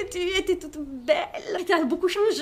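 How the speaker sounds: high, loud, laughing through the prince's shock. Acted. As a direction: play the prince's dismay while giggling. Prince shocked at ugly bride.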